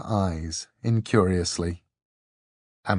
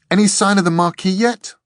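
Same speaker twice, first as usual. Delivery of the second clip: put-on young girl voice; 11 semitones higher, 7 dB louder than usual. A narrator's reading. Dor voiced, still eating.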